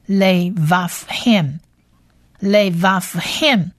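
levavhem split as le-vav-hem, slowly; levavhem pronounced slowly. Shown as a demonstration. Teacher introduces the last word, slow syllable-by-syllable demo. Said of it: "我们来学最后一个字".

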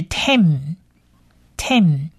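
"Tem" said slowly, closing on a nasal m; nasal ending. Shown as a demonstration. Teacher joining Tay to final mem, nasal ending. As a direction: model "Tem" low and slow, pausing before the repeat. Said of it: "鼻音的嗯的音".

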